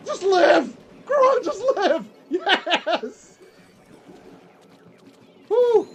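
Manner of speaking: loud, high, laughing cheers of relief; excited shouting. Real, not acted. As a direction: yell at the game in pure excitement. Tense game moment, cheering survival.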